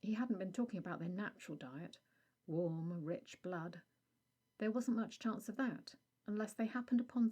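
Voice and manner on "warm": usual speaking voice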